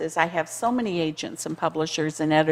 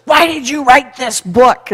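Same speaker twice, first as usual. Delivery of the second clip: stern; 6 semitones higher, 13 dB louder, slower than usual. Retold or imitated. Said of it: "in his very stubborn way, like, stern way".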